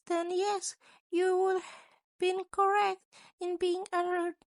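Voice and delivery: a sly creature principal, amused and menacing. A narrator's reading.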